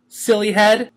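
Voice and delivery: high, cheery pony voice; bright and teasing; Pinkie, joyful. A narrator's reading. Pinkie greeting the waking Twilight, high and cheery. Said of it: "Pinkie Pie said".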